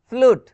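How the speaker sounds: फ्लूट said loud with a long "oo"; pronunciation demo. Shown as a demonstration. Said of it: "sometimes you sounds like बड़े ऊ".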